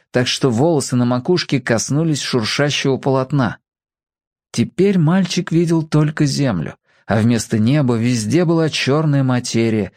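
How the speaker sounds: usual speaking voice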